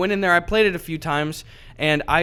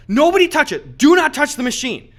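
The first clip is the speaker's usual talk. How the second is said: loud, high emphatic order; retold frantic command; urgent warning; 10 semitones higher, 5 dB louder than usual. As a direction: warn everyone off, word by word. Retold urgent order after winning.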